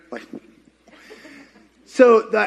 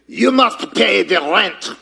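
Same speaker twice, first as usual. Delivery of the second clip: mock villain demanding rent; hammed-up melodrama villain; sneering panto villain; slower than usual. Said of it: "This is supposed to be a mustache".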